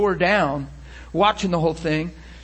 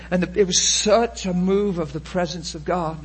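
normal talking voice

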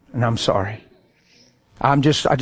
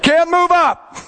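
high, emphatic mock yell; exasperated protest; 13 semitones higher, 4 dB louder, slower than usual. =driver to passenger in jammed traffic, exasperated. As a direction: act out a fed-up driver insisting.

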